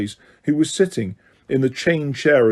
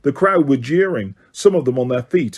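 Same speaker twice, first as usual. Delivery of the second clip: usual speaking voice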